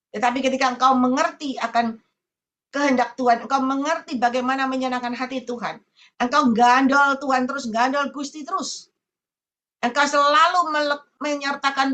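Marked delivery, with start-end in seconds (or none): none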